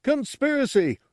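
very high, eager cry; high-pitched simpleton exclaiming; excited announcement. Acted. Gartog announces his big theory.

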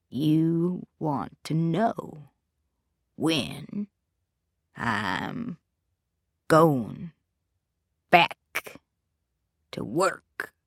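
slow, hollow, drawn-out menace; menacing possessed whisper. A narrator's reading. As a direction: drag each word out like a threat. Possessed father menacing slowly.

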